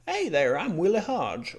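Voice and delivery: high, loud, swooping hammed-up greeting; Willie Hodge. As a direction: play a big, over-eager hello with wide pitch swings. Playful greeting as road-sign character.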